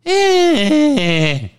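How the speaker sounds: high shrugging grunt; mock grunt. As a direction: shrug it off like Ted. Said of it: "Ted's reply".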